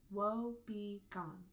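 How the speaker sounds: slow, evenly separated syllables. Shown as a demonstration. Teacher sounding out syllables for counting, slow and separated. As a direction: draw out each syllable for counting. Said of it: "How many spoken syllables do you hear?".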